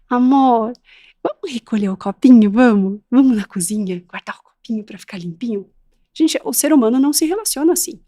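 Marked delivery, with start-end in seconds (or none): 0.1-5.7 mock sugary coaxing, ending in a whisper; syrupy sweet voice. Expert mocking the overly sweet way parents talk. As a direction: overdo the sweetness. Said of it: "Eu tenho que sempre falar assim".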